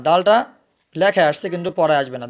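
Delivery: ordinary talk, nothing marked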